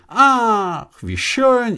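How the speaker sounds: high-pitched mock exclamation of joy; exaggerated happy squeal of words; imitated delighted cry. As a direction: gush it high, imitating an excited young man. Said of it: "Und dann schreien Sie".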